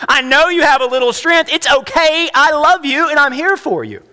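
loud, high, earnest plea as God; voicing God pleading. Pastor voicing God to frightened believers, urgent loving plea. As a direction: speak God's words of love with urgency. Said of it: "God says".

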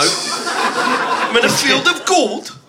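put-on slow, high Geordie accent. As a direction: spell it out slowly in hammed-up Geordie. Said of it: "I'm a Geordie".

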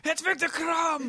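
high, slow, hyped-up cry; excited announcer. Acted. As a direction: call his name like a stadium announcer. Star player appears on screen.

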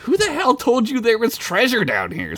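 high, incredulous demand; indignant acted questioning. A narrator's reading. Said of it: "Sid asked".

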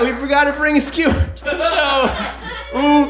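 ordinary delivery

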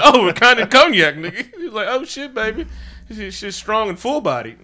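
high, loud hammed-up party voice; laughing mock boast over strong liquor; mock tipsy brag. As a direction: ham it up like a tipsy barfly. Imagined drinking scene, tipsy voices.

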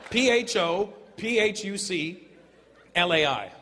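slow letter-by-letter spelling P H O P H U C L A I; spelled out. Shown as a demonstration. Comedian spelling the restaurant name to the crowd, slow and deliberate. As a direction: spell each letter out slowly. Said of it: "Pho Phuc Lai".

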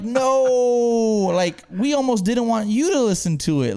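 loud, high, drawn-out no; retold exasperation. Host correcting his friend about the episode, exasperated. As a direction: protest in mock despair.

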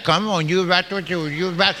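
mock urging through a screwed-up face; contorted-face altar worker. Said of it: "where they do their face like that".

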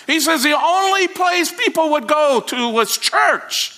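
preaching at full force. Acted. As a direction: build to a triumphant climax. Climax of the sermon story.

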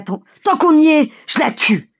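high cry falling at the end; shouting. A narrator's reading. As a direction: fling it out high and reckless. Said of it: "crie Mikolka". (cri haut, emporté)

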